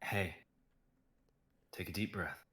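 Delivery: quiet, low, calming; soft reassuring. Acted. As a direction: soothe her gently. Calming crying Rose.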